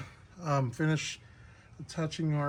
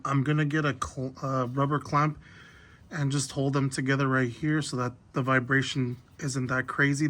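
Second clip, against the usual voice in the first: ordinary talk, nothing marked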